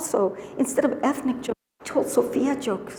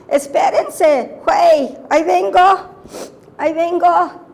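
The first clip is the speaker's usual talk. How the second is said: put-on old Mexican woman. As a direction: play the elderly señora answering the census taker. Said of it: "a woman that I was lucky enough to hear speaking to a census taker".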